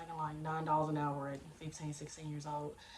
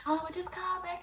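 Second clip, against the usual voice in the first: very high, louder mimicked coworker; mock brush-off; 12 semitones higher, 5 dB louder than usual. Coworker fobbing off a caller, mock high voice.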